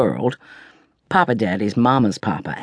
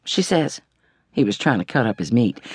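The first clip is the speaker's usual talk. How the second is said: usual speaking voice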